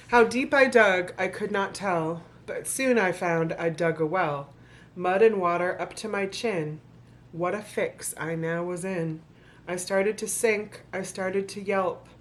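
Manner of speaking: rhythmic storybook reading to an audience; story narration. Acted. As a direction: read the rhymes like a bedtime book. Reads the picture book aloud.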